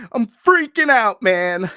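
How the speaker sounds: sudden high panicked yelp; real panic bursting out; freaking out. Real, not acted. Fan watching the fight, panicking out loud. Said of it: "I'm fucking freaking out over here".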